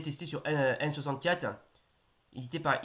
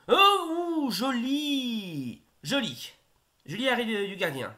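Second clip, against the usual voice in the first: excited commentary; 7 semitones higher, 4 dB louder, slower than usual. Real, not acted. Great save in the match.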